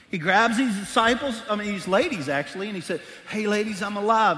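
rally the room; sermon voice lifting; preaching. Acted. Preaching with rising force.

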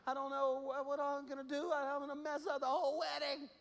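put-on high, rushed child voice; a frightened kid spilling worries; panicky little boy. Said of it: "exactly how he said it".

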